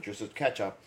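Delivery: normal talking voice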